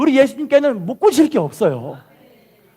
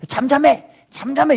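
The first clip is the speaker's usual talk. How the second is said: scolding; 5 semitones higher than usual. Retold or imitated. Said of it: "막 꾸짖으면서".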